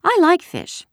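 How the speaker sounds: put-on very high, loud childish voice; silly little girl. A narrator's reading. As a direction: pipe up like a chattering little sister. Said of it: "a silly little girl".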